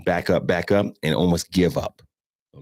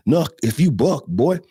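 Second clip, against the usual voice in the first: higher, cocky dog voice taunting; dog's taunt; 7 semitones higher than usual. Retold or imitated. Dog sizing up a scared officer, cocky taunt. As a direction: voice the dog daring you, a touch higher. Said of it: "the dog will look at you like".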